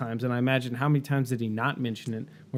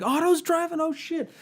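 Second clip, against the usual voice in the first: jumps much higher in sudden alarm; alarmed reader; 14 semitones higher than usual. Retold or imitated. Reader realising the plane is attacking Otto, high alarmed squeak. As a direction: squeak it out as the danger dawns. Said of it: "I'm like".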